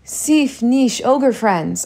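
slow, lilting call. A narrator's reading. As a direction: address a band of ogres, calling them in. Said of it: "I called in Ogrese".

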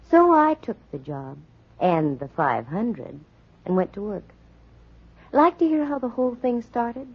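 dry, knowing private-eye narration; noir narration. A narrator's reading. Candy narrates her case to the audience, dry noir. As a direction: tell it wryly to the listener.

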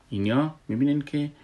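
ordinary talk

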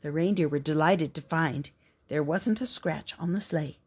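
ordinary delivery, nothing marked

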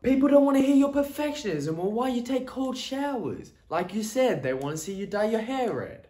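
high-pitched, scolding New York voice; New York accent. Acted. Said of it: "speaking like you're from New York".

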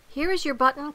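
high, eager call; crying out. A narrator's reading. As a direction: sing out a happy find in a high voice. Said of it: "cried Frog".

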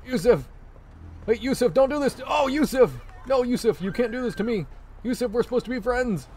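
high-pitched, hammed-up begging; mock desperate pleading. Player begs his game buddy Yusuf to stop, mock desperate pleading.

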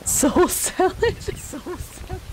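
high, slow laughing disbelief; amused disbelief. Real, not acted. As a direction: echo it back in giggling disbelief. Incredulous repeat, laughing.

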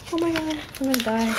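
high, breathless fan gush; fangirl squeal. Real, not acted. Fan gushing over a cute card to viewers, high squeal. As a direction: squeal like it is too cute to bear.

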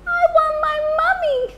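very high, fast squeaky owlet; tiny baby owl voice. Acted. Baby Bill cries for mummy.

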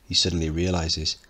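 ordinary delivery

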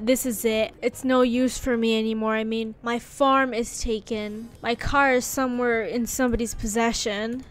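ordinary delivery

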